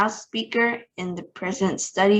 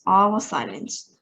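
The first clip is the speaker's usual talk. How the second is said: plain reading voice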